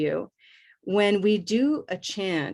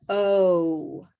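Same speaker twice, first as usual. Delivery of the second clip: ordinary talk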